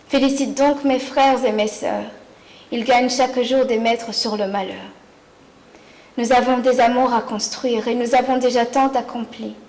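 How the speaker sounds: ordinary talk, nothing marked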